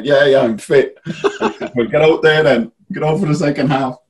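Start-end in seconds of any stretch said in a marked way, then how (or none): 0.0-1.0 higher, louder, laughing as he retells it; laughing retold reply. Retold answer to the physio. 1.7-4.0 higher, louder mock order through laughter; laughing retold order.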